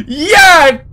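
high, loud gloating; triumphant taunt. Real, not acted. Gamer taunting the beaten Roxy, yelling. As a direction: shout at the defeated foe.

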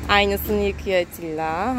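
ordinary talk

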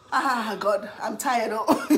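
ordinary talk, nothing marked

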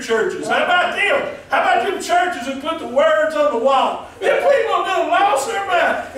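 loud, high laughing rant; fired-up preaching. Real, not acted.

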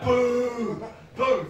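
loud drawn-out mock boo; crowd booing. Comic imitating an audience booing him, loud boo. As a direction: boo like a whole hostile crowd. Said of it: "they're all gonna do it".

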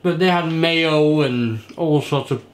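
words slightly muffled by food; talking while chewing. Real, not acted. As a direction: mouth partly full of burger. Seen 1: Chewing after a bite at -4; cheeks bulge while talking.

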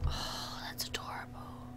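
hushed whispered coo. Real, not acted. Player murmuring over something adorable, whispered. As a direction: whisper it, barely audible. Repeats the gush under breath.

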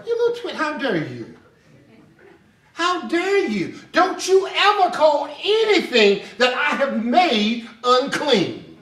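hammed-up, emphatic rebuke; indignant scolding. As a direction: scold like an affronted God. God scolding Peter, preacher's version.

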